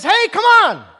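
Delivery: loud, high call. Retold or imitated. Someone calling the blind man, loud and high. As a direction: call him over eagerly. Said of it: "and someone says".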